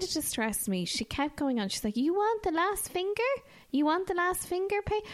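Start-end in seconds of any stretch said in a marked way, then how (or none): 2.0-5.0 high, put-on voice of the nail lady; imitating nail technician. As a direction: mimic the nail lady's odd question.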